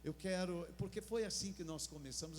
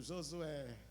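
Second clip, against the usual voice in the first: Josué said with a little more care and warmth; said nicely. Shown as a demonstration. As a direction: say Josué beautifully, a touch fuller. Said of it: "Não, diga bonito". (Josué dito bonito, com capricho)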